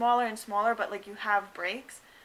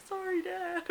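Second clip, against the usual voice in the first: laughing through tears; 8 semitones higher, slower than usual. Retold or imitated. Apologising mid-pain to father.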